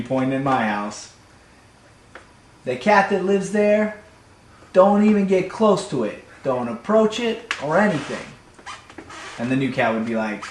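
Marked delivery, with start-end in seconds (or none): 2.6-8.3 warning. Acted. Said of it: "I gotta warn you about this house".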